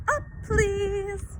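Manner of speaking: high ringing call like a church bell. A narrator's reading. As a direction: ring it out like a bell. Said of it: "voice rang out like a church bell".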